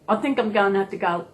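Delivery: ordinary talk, nothing marked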